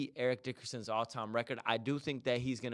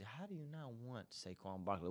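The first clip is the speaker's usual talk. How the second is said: much softer, asked half to himself; muttered question; 2 semitones lower, 16 dB quieter than usual. Real, not acted. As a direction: drop the volume, disbelieving aside. Repeats the question, quieter first.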